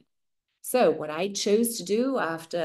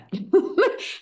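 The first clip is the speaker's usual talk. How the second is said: a short, high, amused laugh; light laugh; 10 semitones higher than usual. Real, not acted. Remembering her toddler sentence "alle zusammen", laughing. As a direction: let a real laugh slip out.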